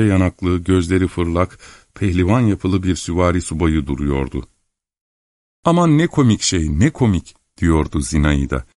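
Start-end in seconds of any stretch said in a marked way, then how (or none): 5.6-7.3 high-pitched amused delight; delighted exclamation. A narrator's reading. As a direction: exclaim over something adorable. Girl delighted by the kitten. (keyifle, tiz bir hayranlıkla)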